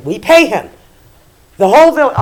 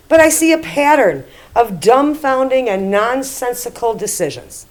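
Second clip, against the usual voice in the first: ordinary talk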